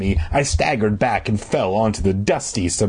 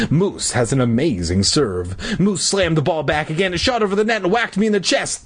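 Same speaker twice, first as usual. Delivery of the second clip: ordinary delivery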